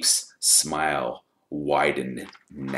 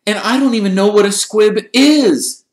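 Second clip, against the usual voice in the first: put-on high, loud boyish denial; indignant protest; 15 dB louder than usual. A narrator's reading. Harry defending himself to the teachers, loud and indignant. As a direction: protest at the top of the voice.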